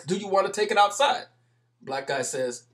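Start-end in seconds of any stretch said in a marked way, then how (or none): 0.0-1.3 lightly voiced mocking dare, a bit raised; taunting challenge. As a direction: tease him into stepping outside.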